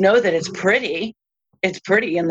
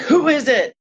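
higher, startled call through the door; nervous call; 8 semitones higher than usual. Retold or imitated. Woken at three by jiggling at the door, calling out higher. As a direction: call out, alarmed.

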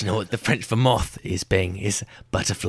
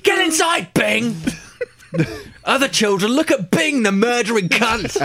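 high, frantic yelling; hysterical yelling; 11 semitones higher than usual. Acted. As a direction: yell it at the kids in fury. Dubbed furious adult.

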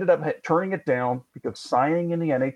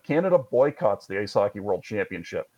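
ordinary talk, nothing marked

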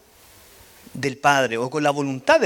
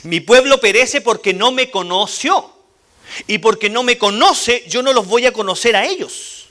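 louder, slower, stressing "conoció" and "conocer"; emphatic reading; 6 semitones higher, 6 dB louder than usual. Shown as a demonstration. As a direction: read it as the true meaning, with weight. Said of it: "El original no es información". (lectura enfática y lenta)

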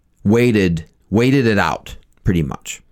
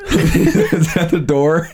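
laughing; 7 semitones higher, much slower than usual. Pretend. Laughing mock voice.

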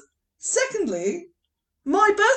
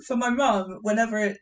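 normal talking voice

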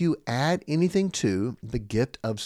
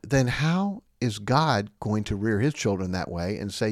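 normal talking voice

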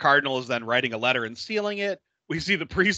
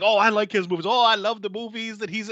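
higher, louder starstruck cop; gushing cop; 7 semitones higher, 4 dB louder than usual. Retold or imitated. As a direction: gush like a fan. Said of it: "they're like".

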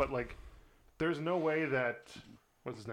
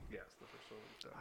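whispered echo; 3 semitones lower, 18 dB quieter than usual. Real, not acted. Quiet echo of co-host's words.

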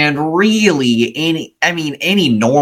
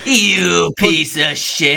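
slow mock insult; 5 semitones higher, much slower than usual. Quoting the furious sergeant.

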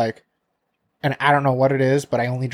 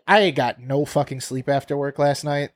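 ordinary delivery

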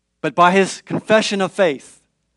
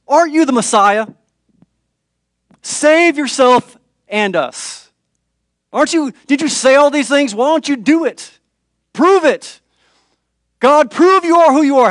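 high, loud sneering taunt, building; mocking voice; 7 semitones higher, 5 dB louder than usual. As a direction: jeer at him and push harder. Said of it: "In a mocking voice".

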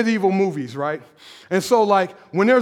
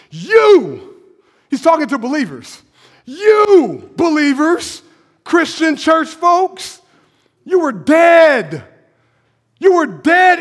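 preaching emphasis; 3 semitones higher, slower than usual. Real, not acted. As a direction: drive it home to the church.

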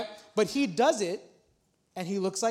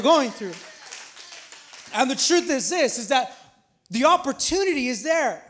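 louder, higher preacher's call; preaching; 4 semitones higher, 6 dB louder than usual. Acted. Pastor calling the congregation to the harvest, preaching loudly. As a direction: urge them to look up and act.

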